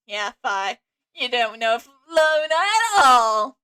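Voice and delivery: hammed-up smug teasing, drawn out at the end; mock smug. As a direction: say it through a smug grin, stretching the last words. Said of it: "A smug grin, like".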